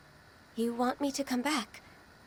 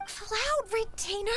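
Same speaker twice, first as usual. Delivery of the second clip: much higher, slowed, scandalised; mortified protest; 11 semitones higher, slower than usual. Real, not acted. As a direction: protest in disbelief at being exposed. Mortified by childhood stories.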